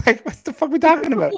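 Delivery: high, fast exasperation; outraged disbelief. Real, not acted. Ranting about the ex in high exasperation. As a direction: throw your hands up.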